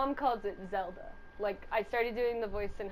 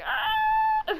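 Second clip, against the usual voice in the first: crying wail; 8 dB louder than usual. Retold or imitated. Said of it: "he would just be like".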